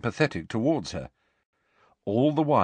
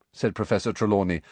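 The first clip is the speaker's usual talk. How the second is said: usual speaking voice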